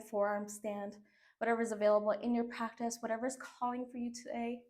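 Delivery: soft, settling instructor voice; calm guided cool-down. Acted. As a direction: ease them gently into stillness.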